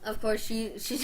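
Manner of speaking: ordinary talk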